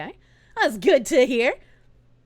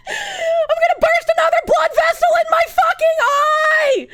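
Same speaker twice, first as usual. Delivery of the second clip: high, screaming and half laughing; screaming rage; 6 dB louder than usual. Real, not acted. Streamer ranting at chat, screaming rage. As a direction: scream until the voice cracks. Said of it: "I'm so angry".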